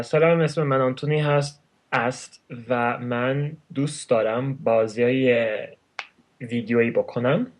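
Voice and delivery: rapid native Persian; very fast. Shown as a demonstration. Introducing himself in his native tongue, very fast. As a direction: rattle it off quickly. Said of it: "I have a habit of speaking very fast".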